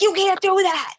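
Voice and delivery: high-pitched panicked cry of you can't do that; panicked retelling. Retold or imitated. To her husband over the frozen turkey, panicked high cry. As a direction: replay the panic, voice jumping way up. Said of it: "having a full-on panic attack".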